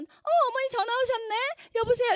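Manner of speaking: morning drama tone. Shown as a demonstration. Answering a mother-in-law's call in a hammed-up morning soap tone. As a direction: ham it up like a morning soap wife. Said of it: "이렇게 받아야 돼요. 약간 톤이 달라요".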